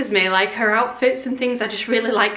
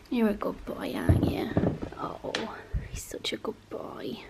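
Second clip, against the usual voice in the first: very soft, half-whispered pet talk; cooing to a pet; 9 dB quieter than usual. Real, not acted. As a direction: coo gently to a sleepy dog. Cooing praise to her dog.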